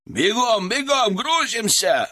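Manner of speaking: громкий высокий окрик; подгоняйте бойцов криком; орёт ротный. Acted. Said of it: "орал ротный". (крик, выше и медленнее)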